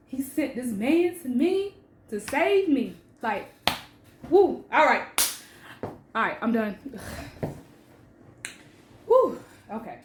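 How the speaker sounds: real emotion breaking through, with whoops; choked up, shaking it off; getting emotional. Real, not acted. Telling the story of being saved, really getting emotional. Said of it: "I'm getting emotional right now".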